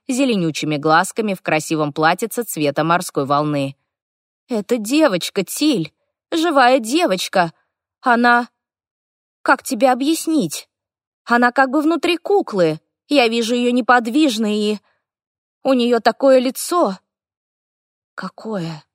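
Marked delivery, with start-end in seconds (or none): 4.5-17.0 put-on high, urgent young boy; a frightened little elf boy, pleading; anxious boy voice. 18.2-18.8 hushed question; whisper. A narrator's reading. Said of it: "спросила я почему-то шёпотом".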